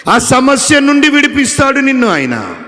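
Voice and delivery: ఎత్తైన, బిగ్గరైన ఉద్వేగపు ప్రసంగం; హల్లెలూయాలతో పైకి లేస్తూ బిగ్గరగా బోధించండి; preaching at full force. Acted. Preaching climax. (ఉచ్చస్థాయిలో, బిగ్గరగా ఉత్సాహపు ప్రసంగం)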